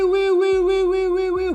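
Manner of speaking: high-pitched wee-wee siren; imitated siren wail. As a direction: wail like a little alarm going off. Sister's alarm-like cry imitated.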